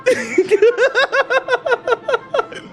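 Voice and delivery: high stuttering cackle; high laugh. Real, not acted. Streamer greeting everybody, high pulsing cackle. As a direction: laugh high in quick pulses.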